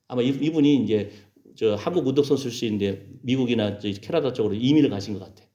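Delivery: normal talking voice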